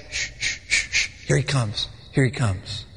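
whispered hushing, then soft low murmurs; hushed gossip. Acted. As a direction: hush each other as he walks by.

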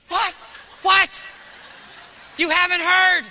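preaching shout. Real, not acted. Pastor shouts at the congregation that hasn't listened, loud. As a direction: yell to wake them up.

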